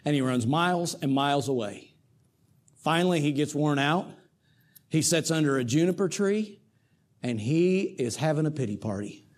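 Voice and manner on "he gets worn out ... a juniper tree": ordinary talk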